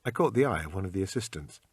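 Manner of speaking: ordinary delivery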